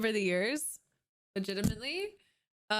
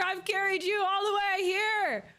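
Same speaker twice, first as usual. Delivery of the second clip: loud rant; 9 semitones higher, 4 dB louder than usual. Real, not acted. Ranting at game teammate.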